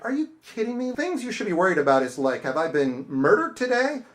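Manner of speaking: high, loud disbelief at a trivial complaint; incredulous retort. Retold or imitated. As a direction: scoff at the customer, voice climbing. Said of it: "And I'm like".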